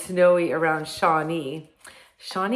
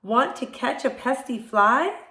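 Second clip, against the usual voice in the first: higher-pitched rooster making an offer; rooster character; 7 semitones higher, 4 dB louder than usual. A narrator's reading. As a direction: call the question out up high as the rooster. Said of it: "cried the rooster".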